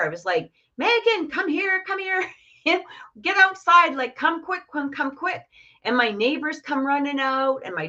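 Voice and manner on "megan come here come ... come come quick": high-pitched urgent call across the yard, shouting her name; calling out for daughter. Retold or imitated. Mother outside calling daughter to see the orb, high urgent shout. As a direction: yell for her to come quick, pitched way up. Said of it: "So I start screaming for my daughter".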